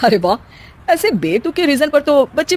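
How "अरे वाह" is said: ordinary delivery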